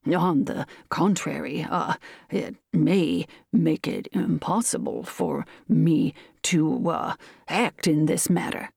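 slow, formal colonel with halts; starchy, hesitant refusal; a little stiff. A narrator's reading. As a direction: object stiffly, word by word. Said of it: "returned quickly and a little stiffly".

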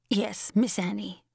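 slow, dragged-out reply; old German servant. A narrator's reading. Old Katy answers Anna without arguing, slow and submissive.

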